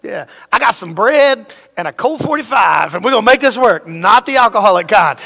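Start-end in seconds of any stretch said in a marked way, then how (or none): 0.5-3.9 mock tough guy. Threatening neighbor at the door.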